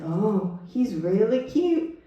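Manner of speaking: slow, drawn-out lady's warning; shelter lady. Retold or imitated. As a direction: stretch the words, pitch climbing. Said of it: "She said".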